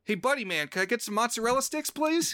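high, quick mock-American accent, rising to the end; Tom Felton. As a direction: overdo a chummy American accent. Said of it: "Tom Felton comes and he says".

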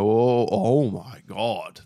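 slow, drawn-out disbelief; retold awestruck gasp of words; stunned reaction. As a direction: stretch it out as the shock sinks in. Retold reaction to shocking film.

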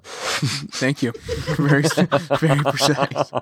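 laughing through the words; amused, giggling reply. Real, not acted. Laughing at overly precise estimate.

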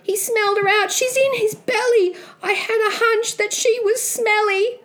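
put-on high-pitched shocked young Jack; crying out. Boy realising the giant ate mum, high alarmed cry. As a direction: blurt it high in boyish alarm. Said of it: "Jack cried".

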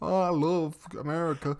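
slow Southern drawl, lightly put on; mock Larry the Cable Guy Southern accent. Host imitating Mater's Southern voice, slow and hammed-up. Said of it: "he speaks a bit like this".

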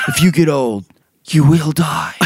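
hammy British doom warning; theatrical British proclamation; mock British accent. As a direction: deliver the warning as a put-on British character. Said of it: "I don't know why he's British now".

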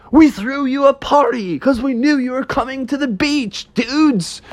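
high-pitched, partying ghost voice; excited dude ghosts. Acted. Ghosts welcoming bears to their party, high excited voice.

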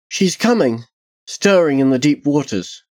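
high-pitched, lightly voiced little girl; child's warning. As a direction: warn as an uneasy child. Child warns of something coming.

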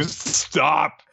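ordinary delivery

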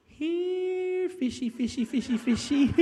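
very high sing-out, like calling a pet; playful fish-calling. Acted. Preacher playing Jesus calling fish from the boat, playfully high-pitched. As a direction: coax the fish in a comically high voice. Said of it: "and he says".

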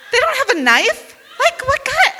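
high, loud laughing disbelief; can't believe it, laugh through it. Real, not acted. Telling audience her disbelief, laughing loudly.

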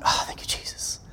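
a whispered thank-you, breath only; relieved whisper. Retold or imitated. Pastor whispering thanks to Jesus, relieved whisper.

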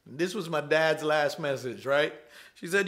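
ordinary delivery

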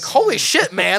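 high, loud burst of holy shit; mock amazement. Uncle shocked by the nephew, loud and high. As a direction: play the stunned uncle loudly.

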